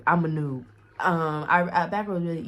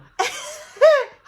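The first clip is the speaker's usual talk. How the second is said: high, loud burst of laughter; helpless high cackle before the story; real laugh; 11 dB louder than usual. Real, not acted. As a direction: crack up loudly, pitched way up. Cracks up before telling the story.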